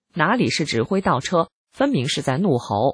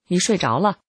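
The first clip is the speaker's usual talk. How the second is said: slightly raised pitch, restrained angry jab; mild ferocity; 4 semitones higher than usual. A narrator's reading. Husband scolding his wife at the wheel, mildly fierce. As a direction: read the husband's accusation with mild menace. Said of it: "丈夫恶狠狠地说".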